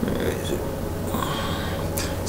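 usual speaking voice

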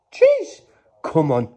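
very high, loud scream of joy; real scream of delight; excited scream. Real, not acted. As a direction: scream it out, thrilled. Celebrating the Charizard.

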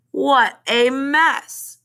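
slow, high, emphatic dismay; storybook dismay, drawn out and pitched high; dismayed exclamation. A narrator's reading. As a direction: stretch each word out in dismay. Reader reacts to spilled liquid.

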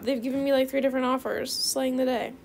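ordinary talk, nothing marked

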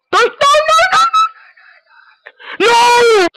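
panicked no. Real, not acted. Opponent about to score.

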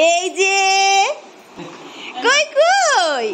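high, drawn-out coo to a newborn. Real, not acted. Aunt coos at the newborn, high and stretched.

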